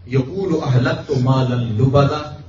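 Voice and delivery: slow, drawn-out Arabic recitation; Quran recitation. Shown as a demonstration. Preacher quoting scripture to the congregation, slow recitation. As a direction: recite the verse slowly and deliberately.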